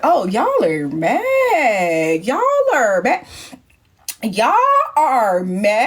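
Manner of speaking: long swooping drawn-out vowels; taunting. Retold or imitated. Host teasing the annoyed housemates, sing-song taunt. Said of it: "I'm like".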